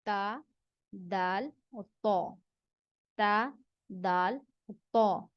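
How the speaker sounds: "ता", "दाल", "तो" said slowly in turn; letter contrast demo. Shown as a demonstration. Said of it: "इन तीनों में".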